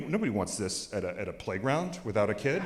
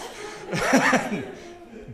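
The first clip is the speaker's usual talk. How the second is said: voiceless laugh trailing down; breathless chuckle; breathy laugh; 9 semitones higher than usual. Real, not acted. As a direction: let out an airy laugh. Laughing after the quote.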